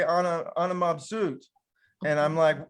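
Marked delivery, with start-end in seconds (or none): 0.0-1.4 "Ana, ana mabsut" said carefully as Arabic, stress on mabsut; Arabic phrase. Retold or imitated. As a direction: pronounce "Ana, ana mabsut" like a learner repeating a phrase. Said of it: "they told me to say".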